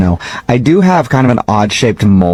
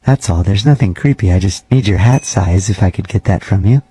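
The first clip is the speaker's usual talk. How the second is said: measured, slightly lowered janitor voice; lightly voiced creepy janitor; 2 semitones lower than usual. Night janitor cold-calling a gym member, lightly creepy voice. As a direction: play a polite, oddly intimate night janitor, understated. Janitor asks hat size.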